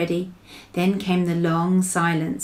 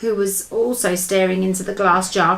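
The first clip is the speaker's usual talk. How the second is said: usual speaking voice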